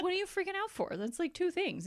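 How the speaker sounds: high, loud playful disbelief; mock incredulity. Honest friend talking someone down, mock incredulous. Friend dismissing the panic, acted.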